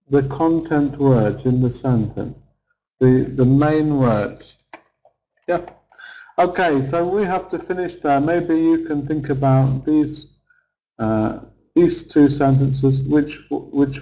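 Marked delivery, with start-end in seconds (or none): none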